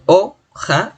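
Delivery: "o, ja" split in two, stress on "o"; stress on first syllable. Shown as a demonstration. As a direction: punch "o", pause, then a lighter "ja". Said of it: "la mayor fuerza de voz está en o".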